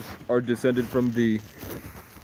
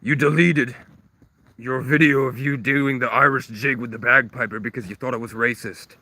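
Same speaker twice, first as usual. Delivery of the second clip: angry; 2 semitones higher, 3 dB louder than usual. Pretend. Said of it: "And I go".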